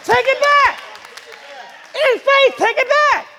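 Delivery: high, loud preacher shouts; fiery pulpit shouting; full-force preaching. Real, not acted. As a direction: punch out short commands to the congregation.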